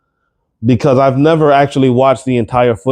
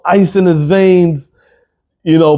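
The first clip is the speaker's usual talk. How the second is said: ordinary delivery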